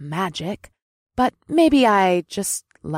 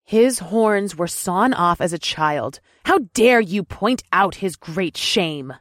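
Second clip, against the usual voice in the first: higher, outraged woman; indignant; 7 semitones higher than usual. A narrator's reading. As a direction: scold with outrage. Amrilla indignant.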